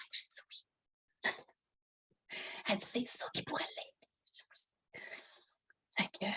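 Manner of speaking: hushed whispered storytelling; whispering. Real, not acted. Mother retelling her surgery while her daughter sleeps, whisper. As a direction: keep telling it under your breath. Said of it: "obligée de parler tout".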